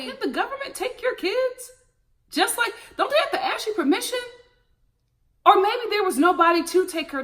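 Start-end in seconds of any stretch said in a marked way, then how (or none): 0.1-1.7 incredulous. Retold or imitated. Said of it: "I'm just like".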